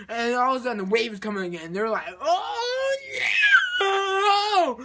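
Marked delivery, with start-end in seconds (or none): 2.2-4.8 long, high, loud wail; hammed-up cartoon shriek of doom; mock terrified scream. Astronauts see the wave coming again, mock scream.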